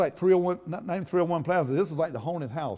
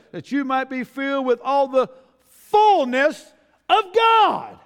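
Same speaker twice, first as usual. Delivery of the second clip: raised voice climbing to a high, loud final word; building to a shout; 8 semitones higher, 7 dB louder, slower than usual. Real, not acted. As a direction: build the verse up and shout its climax. Verse climax after suspenseful repeats.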